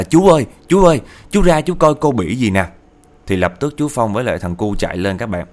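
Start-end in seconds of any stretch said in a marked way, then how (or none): 0.0-2.7 loud, high alarmed cry for help; calling out in alarm. Retold or imitated. Customer yells for the uncle to come see, loud high alarm. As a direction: yell for the uncle, higher and louder. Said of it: "chỉ la lên liền luôn là".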